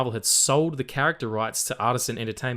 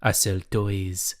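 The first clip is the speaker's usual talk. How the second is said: low, put-on foreign accent of uncertain origin; badly placed accent, lowered pitch, drawn-out ending; Avi Arad accent; 4 semitones lower than usual. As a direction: play a deep-voiced, accented toy boss. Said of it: "where that accent was from".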